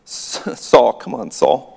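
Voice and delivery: low, soft aside with a chuckle; quietly exasperated, half-laughing reproach; amused chiding. Pretend. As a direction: chide him gently under your breath. Chiding Saul after noting the irony.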